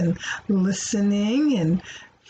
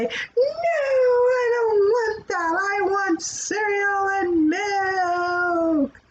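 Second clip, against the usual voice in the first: high, slow whining complaint; whiny child; 10 semitones higher, slower than usual. Retold or imitated. Teacher voicing a child refusing breakfast, slow whine. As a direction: drag it out like a sulky kid.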